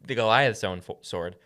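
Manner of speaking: ordinary delivery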